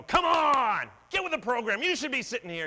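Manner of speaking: loud, high, exasperated rebuke; impatient scolding. Retold or imitated. Jesus as he did not speak, loud impatient rebuke. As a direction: push her to get with it, hammed up. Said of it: "Jesus doesn't go".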